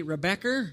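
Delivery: "Rebecca" ending in "er"; New England "Rebecca" with a final "er". Shown as a demonstration. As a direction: finish "Rebecca" with an r sound, as "Rebeck-er". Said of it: "they change it to an E R".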